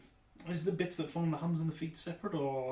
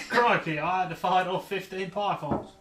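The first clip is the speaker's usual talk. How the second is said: bad Australian accent; 3 semitones higher, 7 dB louder than usual. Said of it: "I can't even do an Australian accent".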